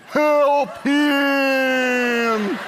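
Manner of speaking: high loud yelping shout; neighbor dog yelp. Pretend. Neighbor dog joins the barking cry for help. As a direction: call out like the dog next door.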